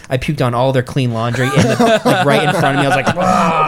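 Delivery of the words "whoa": high mock puking noise; comic vomiting roar; imitated retching. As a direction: act out a sudden heave, pitched up. Imitates himself vomiting on the laundry.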